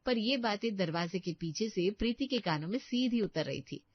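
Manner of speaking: low, calm storyteller narration; story narration. A narrator's reading. Narrator telling listeners what Preeti overhears. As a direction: narrate the scene steadily.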